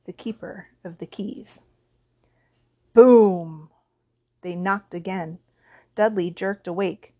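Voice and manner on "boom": loud, drawn-out "boom"; booming bang. A narrator's reading. Narrator sounding a thunderous knock on the door, booming. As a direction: hit it like a heavy knock.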